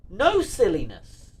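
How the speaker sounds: slow, high, loud refusal; hammed-up stern yell; mock telling-off. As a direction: draw it out and build to a shout.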